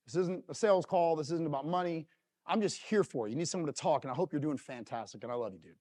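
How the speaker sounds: put-on low, soft words to an old friend; quiet, tender sign-off to a buddy; heartfelt reassurance. Acts out a heartfelt gratitude text.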